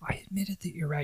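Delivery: softly whispered grudging admission. Retold or imitated. Hero in the duct whispering to his wife. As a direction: whisper it so nobody hears.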